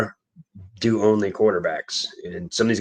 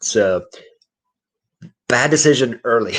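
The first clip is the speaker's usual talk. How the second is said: ordinary delivery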